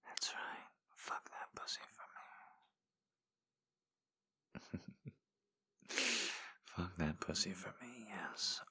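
soft, close whispered encouragement; intimate ASMR whisper. Acted. Voice in a lover's ear, guiding in whisper. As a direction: coax them on in a hushed whisper. Erotic audio whispered guidance.

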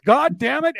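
high, emphatic retold yell; mock yell. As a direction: mimic a gruff man's exasperated cussing. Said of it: "then he would yell whatever".

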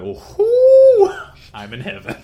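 high, very loud delighted whoop with a laugh; giddy whoop. Retold or imitated. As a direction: whoop like a kid in a candy shop. Waking to two maids.

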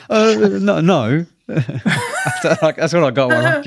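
giggling through the words; real laughter breaking into high refusals; laughing. Real, not acted. Laughing off a request to do an accent.

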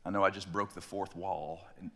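normal talking voice

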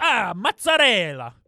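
put-on Italian accent. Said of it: "try and be Italian".

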